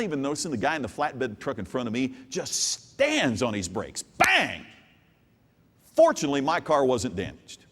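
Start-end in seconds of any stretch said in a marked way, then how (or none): 4.0-4.7 sudden loud exclaimed bang; crash sound. Retold or imitated. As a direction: blurt it like the impact hit. Crash in the story.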